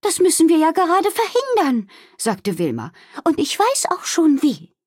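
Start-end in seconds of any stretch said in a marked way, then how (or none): 0.0-1.9 high, urgent character voice; insist, pitched high and urgent; insistent innkeeper Wilma. Acted. Wilma answering Doktor Kautz's doubts, insistent. 3.2-4.8 high, decided character voice; resolute innkeeper Wilma. Acted. Wilma already knows the solution, resolute. Wilma announces she has a plan.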